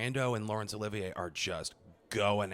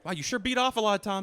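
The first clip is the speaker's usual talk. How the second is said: higher, teasing fake-impressed; mock taunt; 9 semitones higher, 3 dB louder than usual. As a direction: needle him playfully. Mocking paparazzi bit.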